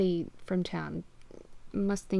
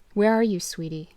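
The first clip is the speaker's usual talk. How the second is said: sternly; 7 dB louder than usual. Acted. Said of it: "I said sternly".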